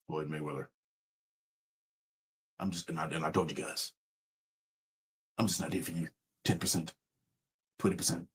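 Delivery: very low, hushed, lifeless statement; mock an insincere endorsement, barely audible; drained, monotone scripted delivery; Floyd Mayweather. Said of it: "say it with sincerity in your voice".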